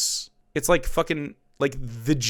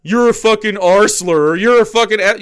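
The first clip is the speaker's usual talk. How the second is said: louder mock insult shout; sneering impression of an online right winger; mock slur shout; 7 semitones higher, 8 dB louder than usual. Mocking right wingers who throw slurs, a mock insult shout. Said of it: "How often do you go".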